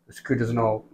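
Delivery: hushed, low imitation of a recruit; meek recruit reply. Recruit caught on lookout answers his instructor, meekly. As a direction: drop right down, small and soft.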